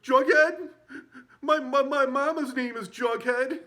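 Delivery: high, loud, slow mocking squeal. Zed mocking the cop's nickname in a loud squeal. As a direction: drag out the taunt.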